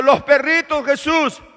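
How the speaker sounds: very loud, high begging shout; woman shouting to Jesus for a crumb; shouting plea. Acted. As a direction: cry out with everything you have. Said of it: "la mujer le está gritando".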